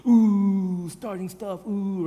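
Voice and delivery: drawn-out taunting oohs; lightly mocked playground jeering; kids egging on a fight. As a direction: mimic schoolkids stirring up trouble. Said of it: "you know how kids are".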